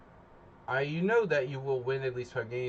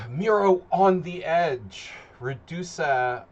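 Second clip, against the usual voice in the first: slow, louder and higher like an announcer; hype announcement; 5 semitones higher, 9 dB louder, slower than usual. Real, not acted. Streamer hypes viewers joining the simul, like an announcer. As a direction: call the names out like a ring announcer.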